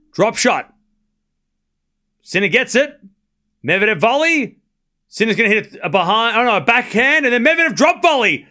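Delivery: excited commentary climbing to the winner; excited call. Real, not acted. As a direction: let the rally lift you. Rising excitement in rally.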